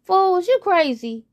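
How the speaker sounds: high, loud scoff; mocking friend. Friend scoffing at the frog, high and loud. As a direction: laugh him off as crazy. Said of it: "says one friend".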